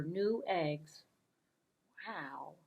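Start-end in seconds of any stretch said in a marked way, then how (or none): none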